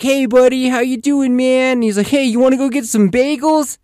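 playful high-pitched buddy greeting; imitating an over-friendly dad; upbeat dude-dad.

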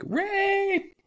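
very high panicked shriek; shrieking woman. Retold or imitated. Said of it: "they're like".

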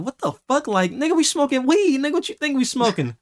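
high, incredulous mock retort; exasperated comeback. Kid answering the man's smoking question, high exasperated retort. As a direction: pitch it way up, as if stating the obvious.

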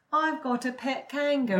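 playful, badly faked Australian voice; mock-Australian character voice; fake Australian accent. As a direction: keep up a silly Aussie accent. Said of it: "faked her Australian accent".

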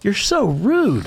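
slow, high mock scolding; shaming. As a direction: stretch out the reproach. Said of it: "shaming grown-ups is really fun".

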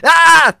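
sudden high, loud shriek of pain; electrocution scream. Acted. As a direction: yelp like you just got zapped. Electrocuted man on ladder.

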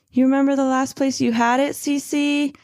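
high, quick imitation of fawning. Narrator imitating the boyfriend looking for her phone, fawning. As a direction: put on a high-pitched, eager-to-please question. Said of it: "like, a fawning voice".

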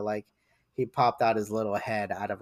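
ordinary talk, nothing marked

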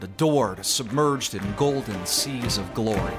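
ordinary delivery

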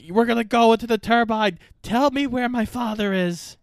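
high-pitched mock interrogation; acted-out threat. Hero to the man he grabbed, high mock threat.

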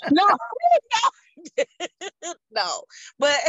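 high giggling through denials; laughing. Real, not acted. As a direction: giggle while saying no. Laughing at porn question.

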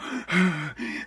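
laboured, soft panting breaths; heavy breathing. Shown as a demonstration. Podcaster showing the breathing the president didn't have, heavy breaths. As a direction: breathe heavily, as if winded.

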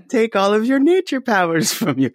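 put-on high, odd old-lady voice; lightly voiced old grandmother. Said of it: "Your grandmother voice".

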